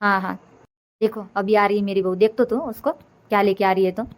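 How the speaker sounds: normal talking voice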